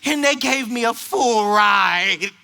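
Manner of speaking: slow, high imitated girl; teen girl. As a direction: draw out the news in a girl's voice. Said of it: "She said".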